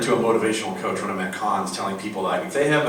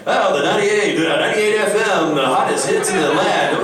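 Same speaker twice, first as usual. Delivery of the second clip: higher, louder hyped radio announcer; 8 semitones higher, 4 dB louder than usual. Retold or imitated. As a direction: sell the station like a cheesy DJ. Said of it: "he turns the microphone on".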